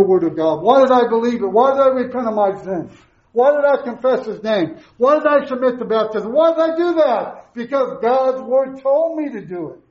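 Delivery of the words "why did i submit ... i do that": ordinary delivery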